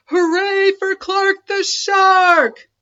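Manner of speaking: very high, loud, slow cheer. A narrator's reading. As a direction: everyone hails the shark. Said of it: "Everyone cheered".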